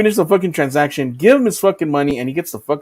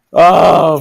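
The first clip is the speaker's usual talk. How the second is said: ordinary talk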